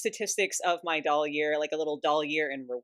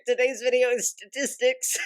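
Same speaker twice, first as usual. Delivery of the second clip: a little louder, bubbling over with joy; barely holding in the giggles; giddy excitement; 6 semitones higher, 5 dB louder than usual. Real, not acted. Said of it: "I'm so excited I can't talk".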